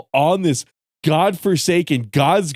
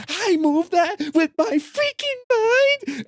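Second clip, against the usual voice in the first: high, drawn-out gushing squeal; slow, high-pitched imitation of an overexcited fan; mock freaking out; slower than usual. Mimicking fans who saw Frey, mock freaking out. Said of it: "freaking the fuck out and being like".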